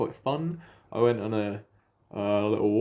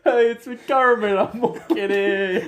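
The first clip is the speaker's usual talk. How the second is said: high puppet frog with drawn-out words; Kermit; 4 semitones higher, 3 dB louder than usual. Shown as a demonstration. Frog announcing himself, high and drawn out. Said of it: "How does Kermit sound?".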